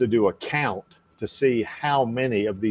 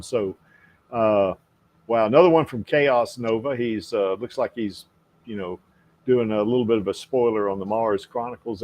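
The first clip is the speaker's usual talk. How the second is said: ordinary talk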